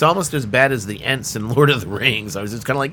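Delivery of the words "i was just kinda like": usual speaking voice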